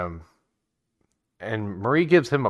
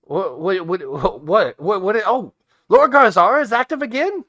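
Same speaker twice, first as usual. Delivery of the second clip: rising, much higher and louder repeated what; alarmed disbelief; 10 semitones higher, 8 dB louder than usual. Acted. Raz learning Lord Garzara is active again, alarmed. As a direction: panic building to a squeak.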